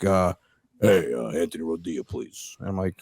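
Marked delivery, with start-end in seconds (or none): 0.8-2.6 low, subdued phone-caller voice; club owner on phone. Retold or imitated. Club owner calling a comic at work, low and understated. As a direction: imitate the owner in a low, softer voice. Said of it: "he's like".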